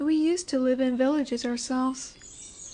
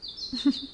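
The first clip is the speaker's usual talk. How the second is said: soft chuckle; 4 dB quieter than usual. Acted. Lamia sparing the human she found, brief amused chuckle.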